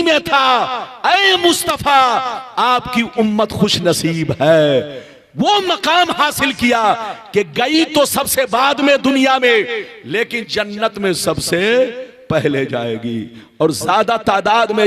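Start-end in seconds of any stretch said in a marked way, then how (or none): none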